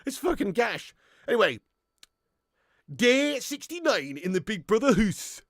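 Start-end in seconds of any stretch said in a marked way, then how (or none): 2.9-5.4 slow, exaggerated Geordie announcer; Geordie narrator impression. Reality show narrator announcing the day, Geordie accent.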